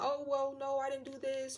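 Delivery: lightly acted victim's excuse; mock worried denial. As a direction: plead innocence in mock distress. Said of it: "want them to be like".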